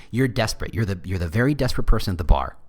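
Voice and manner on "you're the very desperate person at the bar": normal talking voice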